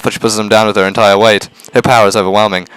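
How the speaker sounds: ordinary delivery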